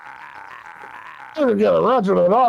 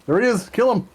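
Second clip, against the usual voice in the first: excited urging; 8 semitones higher, faster than usual. Acted. Players egging on a kill.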